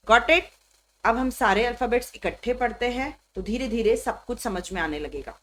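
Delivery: usual speaking voice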